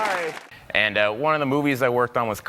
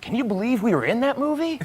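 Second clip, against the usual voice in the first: higher, amazed question; excited Tom Cruise; 8 semitones higher than usual. Retold or imitated. Tom Cruise marvelling to a co-star at the premiere, higher and amazed.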